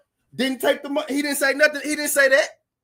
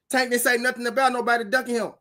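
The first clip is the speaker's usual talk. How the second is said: normal talking voice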